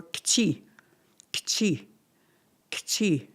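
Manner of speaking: "kchi" repeated with a short falling tune; tricky word kchi. Shown as a demonstration. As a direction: clip each "kchi" downward. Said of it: "Those are tricky words".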